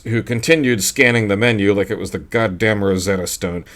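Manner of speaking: usual speaking voice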